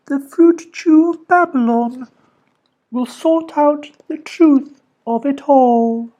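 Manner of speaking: high, slow solemn recital; recited prophecy. A narrator's reading. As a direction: intone it like a prophecy. Polly recites words from stars.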